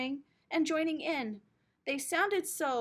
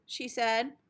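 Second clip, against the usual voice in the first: usual speaking voice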